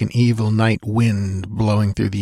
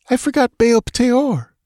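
a frantic dancer cursing; agitated woman voice. A narrator's reading. Voices Zabibi.

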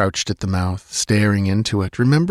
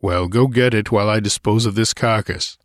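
brusque order from a warrior; commanding. A narrator's reading. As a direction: order her off firmly. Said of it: "he commanded".

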